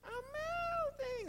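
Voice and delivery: very high, slow, wailing witch cry; Wicked Witch melting. Retold or imitated. Pastor mimics the melting Wicked Witch for the congregation, high slow wail. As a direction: stretch it out like a dying witch. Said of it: "Remember the Wicked Witch of the West?".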